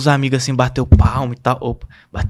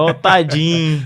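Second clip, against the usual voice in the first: pitying mother; 3 semitones higher, slower than usual. Retold or imitated. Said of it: "ela vai falar".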